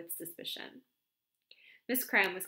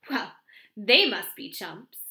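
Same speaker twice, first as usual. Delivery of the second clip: louder, slow scornful marvel; wonder and scorn; 11 dB louder, slower than usual. A narrator's reading. Miss Cram scorning false confessors, wondering and scornful. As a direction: scoff in amazed contempt. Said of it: "in a tone of wonder and scorn".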